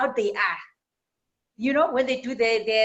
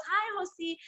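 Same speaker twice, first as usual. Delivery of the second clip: timid puppy; 8 semitones higher, 7 dB quieter than usual. Acted. Timid puppy greets horse.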